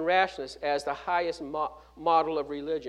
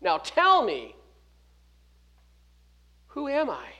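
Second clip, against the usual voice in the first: higher and louder, pressing each word; pointed challenge; 8 semitones higher, 5 dB louder than usual. Retold or imitated. Jesus challenging the disciples to answer, loud pressing voice. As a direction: jab the question at them like a finger. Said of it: "pointing a finger in their heart".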